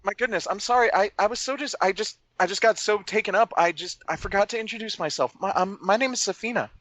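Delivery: eager gnome, lifted in pitch; Safina. Acted. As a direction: tumble through an embarrassed introduction. In-character flustered apology and introduction.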